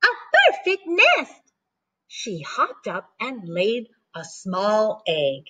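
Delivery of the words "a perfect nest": much higher, thrilled chicken voice; a chicken spotting the nest; delighted chicken. Acted. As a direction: squeal it with delight. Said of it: "she cried".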